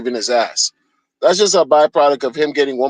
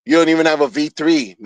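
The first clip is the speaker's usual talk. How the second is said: usual speaking voice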